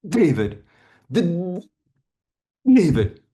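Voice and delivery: "D-, d-, David" with strong repeated D sounds; exaggerated stutter. Shown as a demonstration. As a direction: force the D out, push through the block. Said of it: "I'm gonna exaggerate a stutter now".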